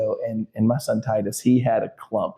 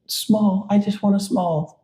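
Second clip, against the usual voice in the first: high little-kid voice, imitated; high-pitched retold child's request; little girl; 10 semitones higher, slower than usual. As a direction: imitate a small daughter piping up. Dad voices little daughter asking.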